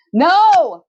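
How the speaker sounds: loud high scream of refusal. A narrator's reading. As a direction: scream it with all you have. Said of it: "I scream".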